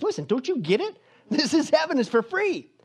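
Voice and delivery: high-pitched, rising impatience as Peter; exasperated. Acted. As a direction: explain it like you're losing patience. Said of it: "he's getting a little exasperated".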